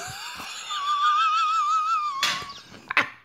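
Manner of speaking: squealing laugh; real high laughter; high cackle. Real, not acted. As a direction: let out a shrieking laugh. Laughing again.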